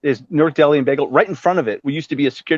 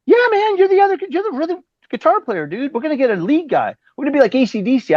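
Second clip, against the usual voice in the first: much higher dude voice, excited pitch; imitating Vince; 12 semitones higher than usual. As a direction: pitch way up and sell the band plan like a hyped rocker. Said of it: "He goes".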